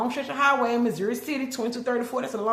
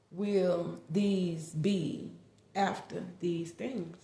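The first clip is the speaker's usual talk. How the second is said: slow, solemn reading of a prayer; measured biblical recitation; scripture reading; slower than usual. Acted. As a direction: read it like a sacred plea. Reads scripture prayer aloud.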